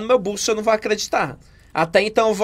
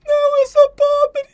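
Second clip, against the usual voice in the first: acted sobbing plea; 12 semitones higher than usual. Victim begging the robber, crying in high tearful voice. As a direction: sob out a desperate plea for mercy. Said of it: "você é muito boa atriz".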